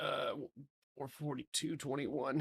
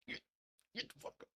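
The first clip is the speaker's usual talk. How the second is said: whispering; 13 dB quieter, much faster than usual. Real, not acted. Urging chart, whispered.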